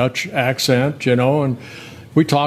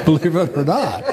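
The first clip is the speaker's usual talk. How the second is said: chuckling through the words; laughing; 4 semitones higher than usual. Real, not acted. Pitcher laughing about nice opposing hitters. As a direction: laugh it out, amused.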